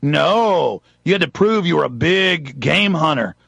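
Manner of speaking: ordinary talk, nothing marked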